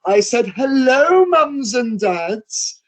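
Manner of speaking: high, slow, drawn-out insistent hello; dame repeating greeting. Retold or imitated. Dame repeating her hello to the silent parents. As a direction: stretch each word out to shame them. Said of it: "the dame goes".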